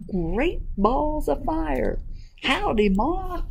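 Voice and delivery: high, drawn-out gosling voice; baby goose. Acted. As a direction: exclaim high as a newly hatched goose. Baby goose greets its mother.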